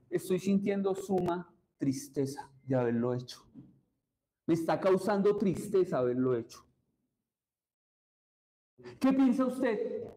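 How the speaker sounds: usual speaking voice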